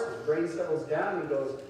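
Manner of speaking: normal talking voice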